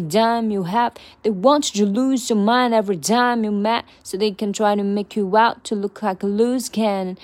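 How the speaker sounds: rhythmic, punchy rap-lyric delivery; lyric recitation. Acted. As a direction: spit the bars with drive. Rapping lyrics as practice.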